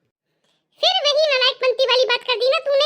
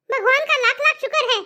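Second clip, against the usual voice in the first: ordinary talk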